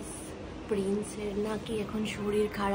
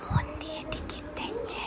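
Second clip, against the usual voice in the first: soft unvoiced whisper; 6 semitones higher, 6 dB quieter than usual. Real, not acted. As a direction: whisper an aside. Whispering aside to viewers.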